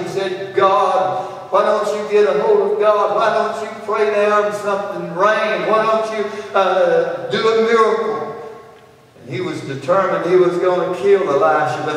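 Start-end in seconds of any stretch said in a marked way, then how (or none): 0.5-8.4 jab each question at the prophet; mocking angry king. Angry king taunts Elisha.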